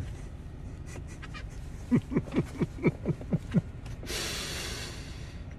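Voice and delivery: high, soft chuckle in even pulses; laugh. Real, not acted. Joking to the ladies about being thirty-nine, high soft laugh. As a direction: chuckle lightly to yourself, high-pitched. Laughs at his own age joke.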